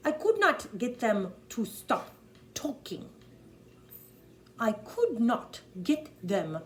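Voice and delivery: slow, emphatic German accent. Shown as a demonstration. Coach's first take of the line, "them" said with a plain T H. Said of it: "they don't have a T H".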